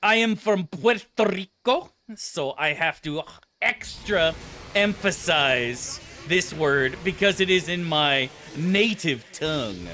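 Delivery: hammed-up Puerto Rican accent, heavily emphasized; mock a Puerto Rican accent with slow, punched words; ridiculous Puerto Rican accent. Mocking someone proud of native tongue, exaggerated Puerto Rican accent. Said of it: "attaching the most ridiculous accent to it ever".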